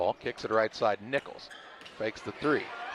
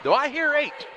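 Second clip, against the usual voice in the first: shout; 13 semitones higher, 5 dB louder than usual. Pretend. Mock auction call.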